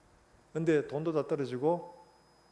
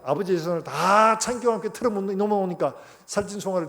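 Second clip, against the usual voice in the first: resentful elder son; 3 semitones higher, 6 dB louder than usual. Retold or imitated. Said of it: "뭐라고 합니까?".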